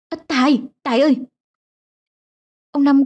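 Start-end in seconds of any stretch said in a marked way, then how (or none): 0.1-1.2 higher, panicked call; panicked cry. A narrator's reading. Terrified mother calls after her leaving son, panicked. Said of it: "hốt hoảng kêu lên".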